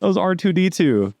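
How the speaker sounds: high, amused scoffing voice; excited scoff. Real, not acted. Mocking the robots as Star Wars.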